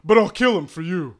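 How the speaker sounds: loud eager servant; eager offer. Acted. Assistant offering.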